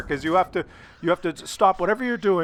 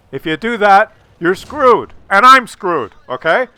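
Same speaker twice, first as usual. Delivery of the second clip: loud, higher warning; emphatic warning; 4 semitones higher, 9 dB louder than usual. Real, not acted. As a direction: raise the voice to warn.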